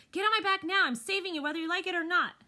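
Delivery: high, loud desperate order; a choked, frantic command; choked commanding shout. Acted. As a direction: force her onto your back. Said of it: "my choked voice".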